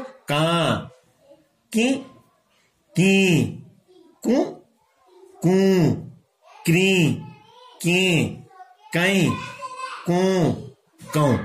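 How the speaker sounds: every vowel from का to कौ sent through the nose; nasalised syllables. Shown as a demonstration. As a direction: recite की, कु, कौ one by one with nasal vowels. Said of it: "ये नासिका से अनुशासिक मैंने उच्चारण किया". (का से कौ तक नाक से अनुनासिक उच्चारण)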